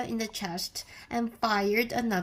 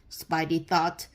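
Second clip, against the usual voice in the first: ordinary delivery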